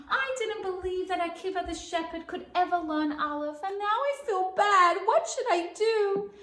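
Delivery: high, loud, crying lament; father puppet lamenting his meanness; remorseful old father. Acted. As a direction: wail your regret.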